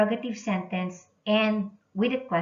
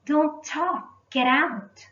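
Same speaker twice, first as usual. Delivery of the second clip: higher and sharper, an angry command; angry order; 8 semitones higher, 3 dB louder, slower than usual. Shown as a demonstration. Teacher acting out an angry exclamation for the class, mock angry command. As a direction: throw them out of the room.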